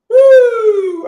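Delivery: high, loud excited whoop. Real, not acted. Streamer whoops at the drug story, loud high whoop. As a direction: let out a big whooping yell.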